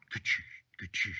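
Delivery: vocal chop sound effect; imitated wood chopping. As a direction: make the noise of an axe hitting wood. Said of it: "sounds like wood chopping".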